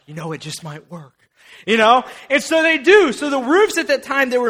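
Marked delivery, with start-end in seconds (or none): 0.1-1.1 low hushed hope. Retold or imitated. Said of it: "that one guy's like".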